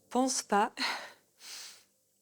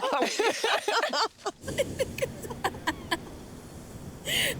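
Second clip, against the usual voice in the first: high laugh; 14 semitones higher, 3 dB quieter than usual. Real, not acted. Laughing at warm-up poses.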